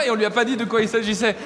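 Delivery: ordinary delivery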